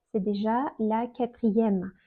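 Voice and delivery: ordinary talk, nothing marked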